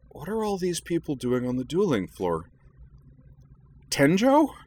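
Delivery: high, incredulous questioning rising to a loud cry; bewildered, pitch shooting up on the name; startled confusion. Acted. Girl coming round on the dueling floor, startled.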